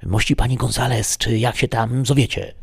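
ordinary delivery, nothing marked

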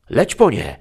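Odysseus roaring at his companion to fetch the chickens, loud. A narrator's reading. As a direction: bellow the command. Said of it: "ryknął Itakijczyk".

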